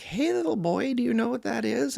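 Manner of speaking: very high sing-song imitation of an older lady. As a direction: put on a high, sweet voice to talk down to a little boy. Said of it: "she's like".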